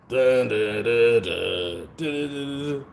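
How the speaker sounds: mouthing a bass beat, dun dun; beatbox the song's rhythm; vocal beat. Retold or imitated.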